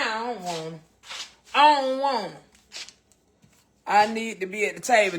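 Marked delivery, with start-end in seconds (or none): 1.5-2.4 higher, louder repeated refusal; emphatic refusal. Real, not acted. Cook refusing beans without ground beef, emphatic. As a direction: put your foot down, loud and high.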